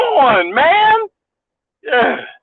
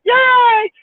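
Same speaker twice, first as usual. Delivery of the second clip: much higher, bright retold whoop; mock cheer. Retelling his glee at a headline, mock cheer. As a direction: squeal it like good news. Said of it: "and I was like".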